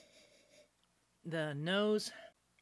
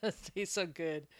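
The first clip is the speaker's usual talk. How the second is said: licking fingers; 4 dB quieter than usual. Real, not acted. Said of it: "I'm gonna lick my fingers".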